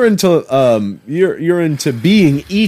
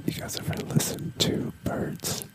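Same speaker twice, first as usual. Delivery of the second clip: soft, high ASMR whisper; 17 dB quieter than usual. Pretend. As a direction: whisper gently into the mic. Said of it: "Do some A S".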